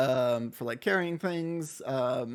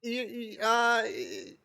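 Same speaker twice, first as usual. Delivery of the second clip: put-on much higher pleading boy voice; nervous Mortimer; 8 semitones higher, slower than usual. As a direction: plead with the customer. Shop boy objects.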